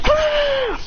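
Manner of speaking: very high battle cry; attack scream. Acted. Fighter blasting the tail beasts, very high attack cry.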